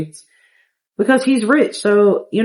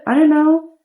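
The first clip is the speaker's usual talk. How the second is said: a lightly voiced girl caught off guard; surprised Sim girl; 8 semitones higher than usual. Woman Sim learns he'll stay for the weekend, higher and surprised.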